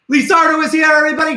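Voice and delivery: loud, high showman cry; excited announcement. Real, not acted. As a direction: present the star of the show. Host presents puppet Lizardo.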